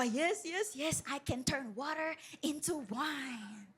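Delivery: a hint of a drunk, soft and slow; lightly voiced tipsy guy; mock drunk. Preacher mimics drunk churchgoer, mildly slurred and soft. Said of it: "Sabi nung iba".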